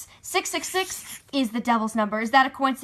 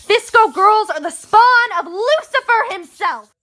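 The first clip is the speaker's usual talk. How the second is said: dramatic reveal; 9 semitones higher, 7 dB louder than usual. Acted. Dramatic conspiracy theory reveal.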